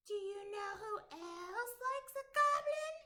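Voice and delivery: very high, polite little voice; mystery voice. Acted. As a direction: pipe up from the dark. Voice in the dark.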